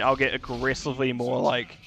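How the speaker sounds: ordinary talk, nothing marked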